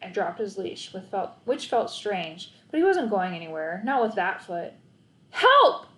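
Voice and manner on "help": high, loud cry for help; yelling for help. A narrator's reading. Boy shouting for help to a passing car, high and loud. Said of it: "I yelled".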